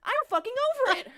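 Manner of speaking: high, worked-up threat; fed-up ranting. Real, not acted. Threatening rant at an online heckler.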